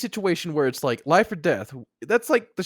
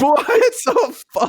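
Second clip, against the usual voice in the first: laughing disbelief; 11 dB louder, much slower than usual. Real, not acted.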